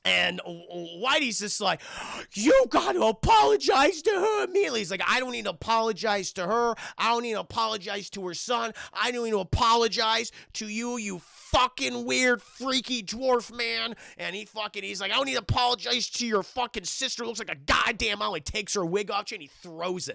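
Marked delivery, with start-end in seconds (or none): 2.3-4.8 very high, loud squeaky demand; hammed-up shrieky character shout; scolding Whitey voice. 5.1-14.0 raised, louder, defiant retort; heated acted argument; angry refusal. Adam refuses in a quarrel. 15.1-18.3 raised-pitch defiant retort; heated acted tirade; angry insult. Adam continues insulting in quarrel.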